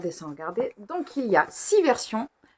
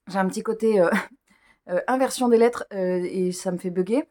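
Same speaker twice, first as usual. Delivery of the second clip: usual speaking voice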